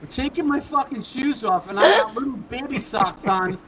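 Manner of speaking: high, loud, cracking up; helpless laughing retelling; laughing disbelief. Retold or imitated. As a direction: squeal it through laughter as the embarrassment builds. Retelling his embarrassment, cracking up.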